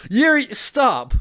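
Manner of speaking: high, slow pleading call to stop; loud plea. Acted. Sayori begs Yuri to stop, high slow call. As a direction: beg her to stop, stretched out. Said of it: "Sayori said it loudly".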